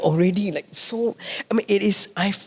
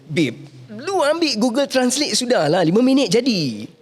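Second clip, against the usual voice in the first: higher-pitched quote of a laid-back rocker pal; rocker friend; 8 semitones higher than usual. Retold or imitated. Rocker friend telling translator to use Google Translate, imitated rocker. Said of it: "One of my singer friends, uh, singer, as in rocker".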